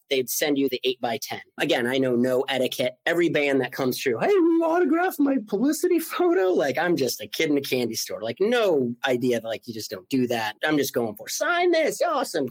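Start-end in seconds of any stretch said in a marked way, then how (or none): 4.2-6.5 high, eager fan voice; eager kid fan. Retold or imitated. Kid fan asking bands. 11.3-12.5 put-on eager kid fan. Kid fan asking bands.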